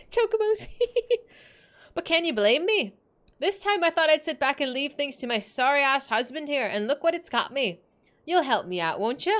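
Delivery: lightly voiced Scottish mum pleading; Scottish mother. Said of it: "I don't know where the Scottish accent came from".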